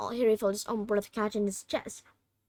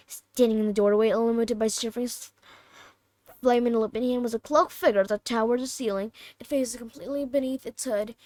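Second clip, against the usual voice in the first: usual speaking voice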